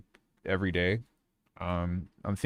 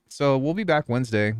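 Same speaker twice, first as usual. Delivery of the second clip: ordinary talk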